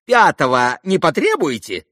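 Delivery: high, loud challenge; high-pitched defiant taunt; challenging captain. A narrator's reading. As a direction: throw out the question in a high, strained captain's voice. Captain's challenge before narrator describes him.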